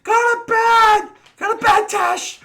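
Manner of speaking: high, loud, slow mom shout; a mother hollering up the stairs; mom yelling. Retold or imitated. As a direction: mom calling her kid to bed. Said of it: "like, Mom".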